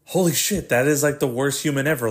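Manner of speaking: high, appalled exclamation; surprised. Acted. Internet user seeing the worst of people, acted surprise. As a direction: react in disbelief, pitch jumping up. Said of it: "sometimes I'm still fucking surprised".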